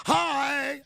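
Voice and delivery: high loud bubbly hi; mock elated greeting. To the crowd, showing elation, a high loud chirpy hi. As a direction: burst out like an overjoyed host.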